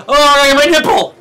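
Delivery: loud high cry of alarm; frantic high yell; panicked yell. Retold or imitated. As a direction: shout it like you're grabbing something falling. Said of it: "retold panic in shower".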